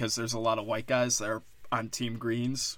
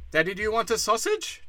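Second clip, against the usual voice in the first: mock eager offer; 9 semitones higher than usual. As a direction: push the offer up high and eager. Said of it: "We've always been like".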